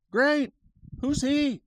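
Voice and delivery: put-on very high, loud kid voice; clueless child. Child replying to mom's big reveal, cluelessly chirpy. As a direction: imitate an oblivious kid piping up.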